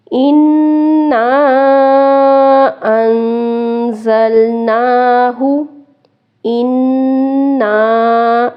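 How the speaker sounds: اِنَّا with a long held nasal on the doubled noon; nasal ghunna. Shown as a demonstration. Teacher demonstrates the held nasal in اِنَّا. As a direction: hold the nasal in اِنَّا for one count. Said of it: "نون شد غنہ کریں گے".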